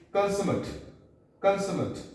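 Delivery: consummate ending in a short, weak -mut; adjective pronunciation. Shown as a demonstration. Teacher models the word for learners, consummate ending in -mut. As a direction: say consummate as KUN-suh-mut. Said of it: "As a verb, this will be pronounced as".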